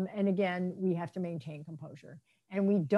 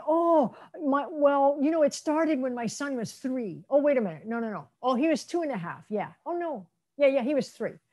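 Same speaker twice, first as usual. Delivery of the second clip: higher-pitched, self-correcting parent; unsure parent; 5 semitones higher than usual. Retold or imitated. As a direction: play a parent muddling through a memory. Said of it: "parent's not very clear about the time".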